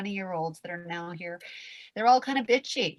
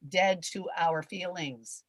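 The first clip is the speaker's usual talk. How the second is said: ordinary talk, nothing marked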